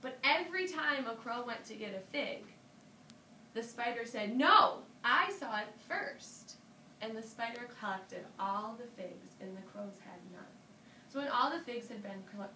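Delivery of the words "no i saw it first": loud, high, drawn-out objection; greedy protest. A narrator's reading. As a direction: claim it loudly like a greedy spider. Spider grabs figs from the crows.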